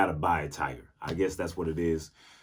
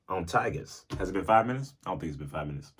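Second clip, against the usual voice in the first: ordinary talk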